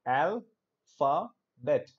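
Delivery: Alphabet drawn out slowly, each syllable given its own beat. Shown as a demonstration. Teacher showing the class the syllables of the word, slowly. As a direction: stretch out Alphabet so the three syllables can be heard. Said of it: "it has three syllables".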